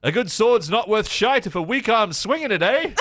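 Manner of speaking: high-pitched, hearty island character voice; hearty seafaring islander. Acted. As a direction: put on a seafarer's catchphrase voice. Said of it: "this one guy always goes".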